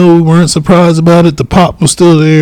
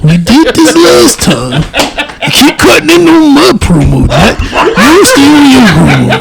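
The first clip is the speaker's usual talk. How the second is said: laughing; 10 semitones higher than usual. Real, not acted. Laughing protest at interruption.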